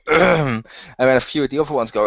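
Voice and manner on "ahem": attention-seeking "ahem"; loud hint of a cough; pointed throat-clear. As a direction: clear your throat to be noticed. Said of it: "you see Rex go".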